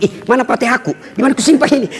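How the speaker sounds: high, hammed-up bewilderment; mock panic. Preacher pretending his Fatihah went missing, mock panic. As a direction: pretend you have frantically lost something.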